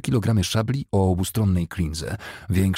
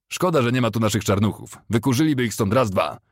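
higher, projected soldier's call; moderately raised voice, pitched up; Ambrose Bell; 4 semitones higher, 3 dB louder than usual. A narrator's reading. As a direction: call boastfully to comrades. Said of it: "zawołał Ambrose Bell".

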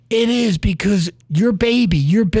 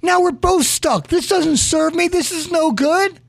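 fuming; 12 semitones higher than usual. Retold or imitated. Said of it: "is fuming".

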